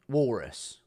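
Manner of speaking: ordinary talk, nothing marked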